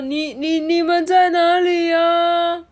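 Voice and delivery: loud, high calling with long drawn-out end; blindfolded doctor character. A narrator's reading. Blindfolded doctor hunting friends in hide-and-seek, singsong calling. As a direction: call out searchingly in a high singsong. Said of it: "布姆博士说".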